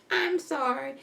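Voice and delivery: a lightly voiced timid girl; a small, sheepish apology; meek apology voice. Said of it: "switched around four or five different voices".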